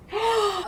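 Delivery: sharp high gasp; distressed gasp. Real, not acted. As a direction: gasp as if hit by bad news. Overcome while reading sad book.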